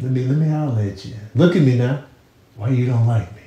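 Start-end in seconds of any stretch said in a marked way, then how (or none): none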